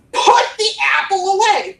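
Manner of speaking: loud, high, urgent command; urgent shout. Shown as a demonstration. Coach showing urgency by volume, urgent shout. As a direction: shout the order out. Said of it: "Nobody's gonna ever shout".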